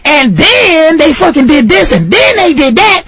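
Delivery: high-pitched mock testimony; accuser in court. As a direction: act out an accuser piling on claims, high. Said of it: "the bitch was in court going".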